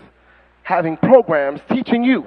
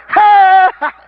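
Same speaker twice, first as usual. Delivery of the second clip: mocking monkey hoot; 10 semitones higher, 6 dB louder than usual. Crowd reacting like monkeys.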